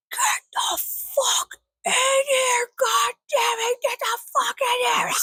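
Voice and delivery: high, drawn-out shrieked imitation; screaming neighbor. Neighbor yelling at her dogs, imitated screaming. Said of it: "her scream at the top of her lungs".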